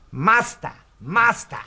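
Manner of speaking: high, loud mock pupil's call; calling to master. Retelling an ad to mates, pupil calling his master. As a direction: call out sharply to your master. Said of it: "keeps on saying to the old bloke".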